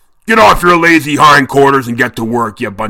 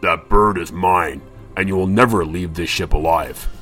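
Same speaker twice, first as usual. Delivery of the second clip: Redbeard's threat; 5 semitones lower than usual. A narrator's reading. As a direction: promise death coldly. Captain threatens Bluebeard.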